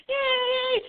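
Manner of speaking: high joyful "Yay!"; excited scream. Retold or imitated. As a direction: shriek it with delight. Cheering her reopening.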